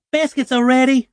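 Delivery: high, loud call from the kitchen; mother hollering. Retold or imitated. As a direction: holler it up the stairs like Mama. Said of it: "Mama hollered".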